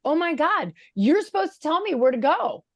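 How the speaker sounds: fed up, not screaming; exasperated mom. Retold or imitated. Mother scolding her son for bad directions, exasperated. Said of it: "I didn't scream".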